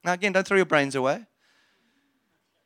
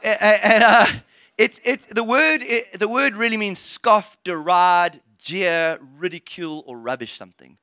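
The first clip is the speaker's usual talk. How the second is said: usual speaking voice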